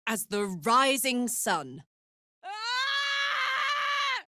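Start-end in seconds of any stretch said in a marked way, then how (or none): 2.4-4.2 high sharp yell of pain; pained cry. Acted. Hit in battle, a sudden high cry of pain. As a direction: cry out as if struck.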